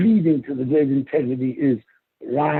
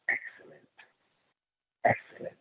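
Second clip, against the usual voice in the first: whispered; 12 dB quieter than usual. Shown as a demonstration. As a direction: whisper excellent, unvoiced.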